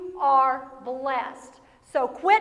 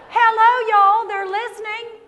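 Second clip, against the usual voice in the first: ordinary talk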